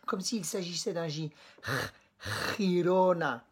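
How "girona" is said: Girona with a throaty rasped G like a J; Girona, G scraped in the throat, Spanish style; Spanish G before I. Shown as a demonstration. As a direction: rasp the back of the throat on the G of Girona. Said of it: "Là, il faut te racler la gorge".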